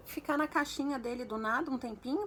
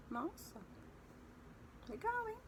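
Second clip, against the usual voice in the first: sarcastic reply; 11 semitones higher, 9 dB quieter than usual. Retold or imitated. Cold reply to her excitement.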